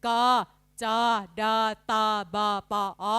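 evenly spaced phonics drill, one letter at a time; letter-sound drill. Shown as a demonstration. Teacher drilling middle-class consonant sounds, letter-sound drill. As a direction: recite each consonant sound separately. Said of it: "ขึ้นไปคุมด้วยเสียงพยัญชนะอักษรกลางก่อน".

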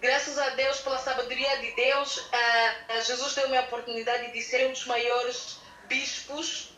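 usual speaking voice

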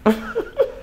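short, high, breathy laugh; real laugh on the edge of crying; tearful laugh. Real, not acted. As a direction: laugh through tears. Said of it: "You got me crying".

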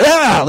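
short high mock yell; televangelist sound effect. Televangelist performing a miracle, sudden high cry. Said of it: "like, a sound effect".

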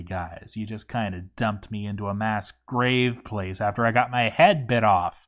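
ordinary delivery

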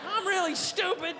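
sheepish and dumb; dog admitting to owner it's stupid; dopey dog. Acted.

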